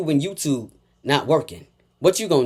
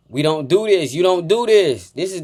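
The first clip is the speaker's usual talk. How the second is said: normal talking voice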